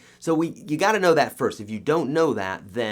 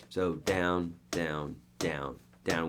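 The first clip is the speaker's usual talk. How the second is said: soft steady count of down; counting downbeats; 4 semitones lower, 6 dB quieter, much slower than usual. Shown as a demonstration. Teacher counting downbeats for students, soft steady count. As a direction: tap out each down evenly. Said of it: "That's called a downbeat".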